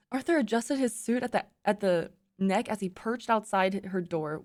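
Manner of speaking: ordinary delivery, nothing marked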